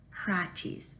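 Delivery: praties, whispered softly and slowly as prat-ees. Shown as a demonstration. Teacher introduces the word praties to children, whispering it. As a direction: breathe out the word praties like a secret. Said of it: "It's a fun word to say".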